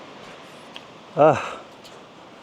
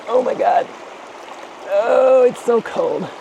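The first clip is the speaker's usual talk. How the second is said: cold shock; 8 semitones higher than usual. Real, not acted. Stepping into icy river water.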